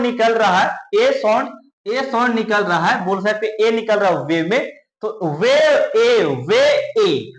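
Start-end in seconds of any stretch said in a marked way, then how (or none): 5.4-7.3 "वे ए" said slowly, stretching the long ay vowel; drawn-out vowel demo. Shown as a demonstration. Teacher has pupils say it along, drawn-out vowel demo. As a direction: hold the ay in "वे", then "ए" alone. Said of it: "बोलकर देखिए मेरे साथ".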